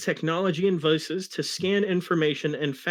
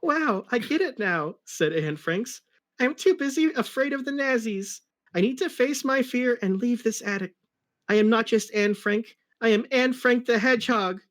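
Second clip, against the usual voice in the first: put-on girl character voice; 8 semitones higher than usual. Anne Frank's character voice.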